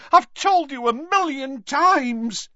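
high, loud, slow insistence; desperate shout. A narrator's reading. Tonks shaking Lupin's robes, desperate shout. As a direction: shout it while shaking him.

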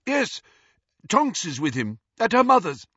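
high, overloud small talk; loud. A narrator's reading. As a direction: keep chatting loudly through the tension. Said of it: "Lupin said loudly".

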